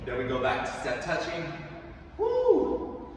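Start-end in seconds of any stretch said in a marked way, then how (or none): 2.2-3.1 excited whoop. Real, not acted. Hype shout in class.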